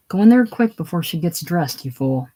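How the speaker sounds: ordinary talk, nothing marked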